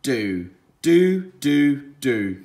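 "Do" said slowly and clearly, repeated; sounding out do. Shown as a demonstration. Teacher modelling the word do for children. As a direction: model "Do" for them to copy. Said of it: "so this word is do".

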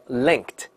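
"linked" with -ed said as t; "linked", a light unvoiced t after the k; voiceless t ending. Shown as a demonstration. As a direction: model "linked" as one syllable ending in t. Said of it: "又是个清音".

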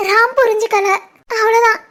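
ordinary talk

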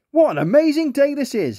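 high, delighted lobster voice; a cheerful lobster marvelling at his day; beaming. A narrator's reading. Lobster delighted by his day, high and beaming. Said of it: "he beamed".

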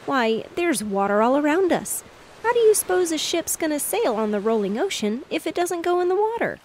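put-on high-pitched, rising boy's outburst of disbelief; a small boy crying out, amazed she can't see it; excited cry. A narrator's reading. Boy insists to sister the grass is water, excited cry. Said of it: "cried Bunny Brown".

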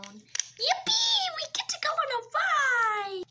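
high squealing glee; excited. Acted. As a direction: cheer like a kid on a ride. Patient excited by bed ride.

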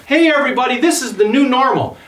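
high, bright mock video host; mock broadcaster voice. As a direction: hype it up like a cheesy online host. Mocking upbeat online broadcast greeting.

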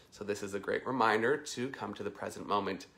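ordinary talk, nothing marked

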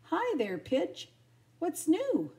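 high, chirpy hello; loud, carefree storybook greeting; breezy, nonchalant. A narrator's reading. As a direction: greet as if nothing had happened. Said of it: "acting as if nothing had happened".

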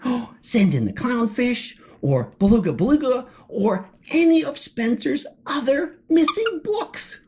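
usual speaking voice

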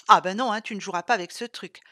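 loud, sudden "no" to a pet; forceful telling-off; firm scolding. Acted. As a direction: cut the dog off loudly. Owner scolds eager dog.

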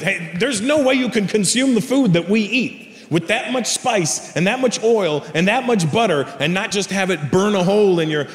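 ordinary talk

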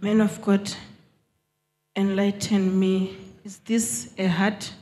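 usual speaking voice

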